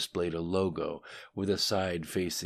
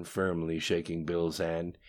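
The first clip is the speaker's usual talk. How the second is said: usual speaking voice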